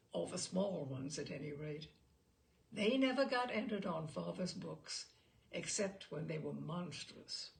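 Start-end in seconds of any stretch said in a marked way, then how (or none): none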